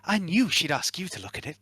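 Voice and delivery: high, bright machine-spirit voice; Alyssa voice. Acted. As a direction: voice the little device up high. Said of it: "she shouldn't speak like that".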